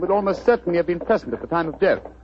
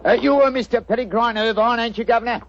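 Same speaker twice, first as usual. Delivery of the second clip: chirpy cockney delivery pitched up; cockney disguise; 6 semitones higher than usual. Acted. Disguised detective greets the homeowner in cockney. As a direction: play a cheeky London workman. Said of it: "What barbaric jargon do you speak?".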